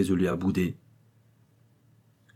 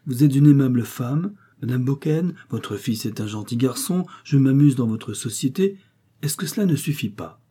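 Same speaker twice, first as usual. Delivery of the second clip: higher, lively flattering lines; a hint of a wheedling visitor; lightly voiced jovial suitor; 6 semitones higher, 4 dB louder than usual. A narrator's reading. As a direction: lightly play a man courting a widow.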